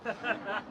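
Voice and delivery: a brief amused chuckle at the crowd; short laugh. Real, not acted. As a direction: laugh lightly at your own joke. Joking about ending speech.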